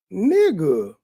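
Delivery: ordinary talk, nothing marked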